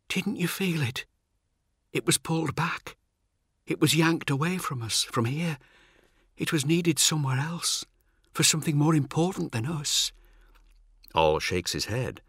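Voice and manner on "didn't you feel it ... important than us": high-pitched, lightly voiced character; weakened Cat. Limp Cat tells Ol the demon left, high voice. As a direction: explain in a high, unforced voice.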